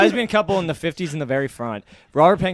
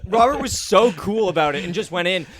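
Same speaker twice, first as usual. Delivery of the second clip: ordinary talk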